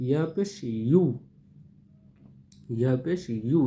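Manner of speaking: ইউ said firmly and loud. Shown as a demonstration. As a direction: give ইউ a hard push. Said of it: "এটা একটু শক্ত করে বলার চেষ্টা করবেন".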